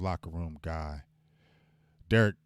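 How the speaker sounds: usual speaking voice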